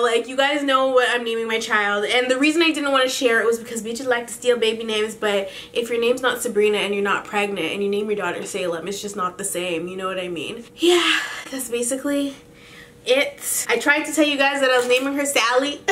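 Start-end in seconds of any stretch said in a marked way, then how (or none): none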